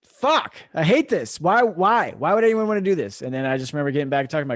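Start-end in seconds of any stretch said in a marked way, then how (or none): 0.0-3.2 reenacted outburst, high and loud; hammed-up miserable protest; exasperated complaint. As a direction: gripe loudly as if fed up. Acting out his misery in the snow.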